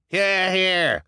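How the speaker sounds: high loud cheering; calling agreement. Acted. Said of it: "called several voices in agreement".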